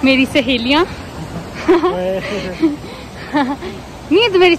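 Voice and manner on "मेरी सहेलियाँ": ordinary delivery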